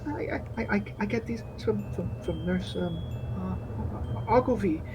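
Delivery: high, quiet, halting excuses; nervous stammer. Acted. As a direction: stammer timidly in a small high voice. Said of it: "She's like".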